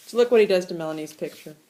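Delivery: ordinary delivery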